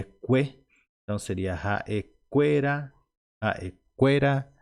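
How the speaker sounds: "raequera" leaning on the syllable que; weight on QUE in "raequera" and "Aequera"; penultimate stress. Shown as a demonstration. Teacher shows learners where the accent falls, heavy QUE in "raequera". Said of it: "para pesar nesta penúltima sílaba".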